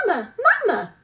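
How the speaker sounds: high, loud little chick cry; peeping gosling. Acted. Newborn gosling calling to the robot, high peeping cry. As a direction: peep it out like a hungry baby bird. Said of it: "peeped the gosling".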